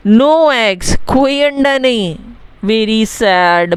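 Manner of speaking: ordinary talk, nothing marked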